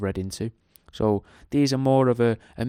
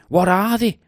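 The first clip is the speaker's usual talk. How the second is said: dramatic question; 9 semitones higher, 7 dB louder than usual. Acted. Dramatic question in show intro.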